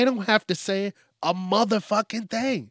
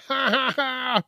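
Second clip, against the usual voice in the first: high-pitched imitated cackle; mock noisy laugh; 8 semitones higher than usual. Imitating someone noisy while eating in public, mock laugh. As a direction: burst out in a high, put-on laugh.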